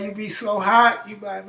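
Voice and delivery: usual speaking voice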